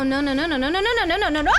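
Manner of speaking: real panicked chant of no. Real, not acted. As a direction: build the nos into a panicked yell. About to die in game.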